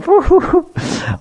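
imitated high hooting giggle; put-on snickering hoots; mocking laughter. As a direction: hoot like kids making fun behind someone's back. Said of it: "and the friends would go".

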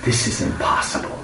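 soft whisper to herself; whispered thought. Acted. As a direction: breathe it as inner thought. Radharani thinking to herself.

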